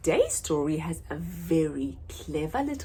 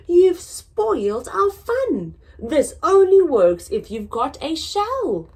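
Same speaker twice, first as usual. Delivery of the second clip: mild scolding; 9 semitones higher, 9 dB louder than usual. Acted. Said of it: "they cried".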